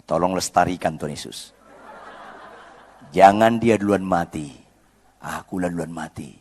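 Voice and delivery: husband praying about an angry wife; mock prayer. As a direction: tease husbands with a humble prayer. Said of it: "bilang sama Tuhan".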